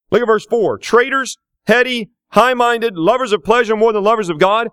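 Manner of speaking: loud, rising preacher voice; full-force preaching. Acted. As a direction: drive each phrase home from the pulpit.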